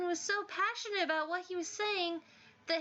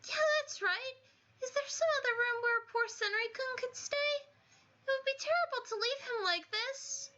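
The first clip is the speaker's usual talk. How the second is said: much higher, gentle worried voice; timid sweet student; 6 semitones higher than usual. Acted. Timid student worrying to Kakeru about Senri, high and gentle. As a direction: plead kindly for him.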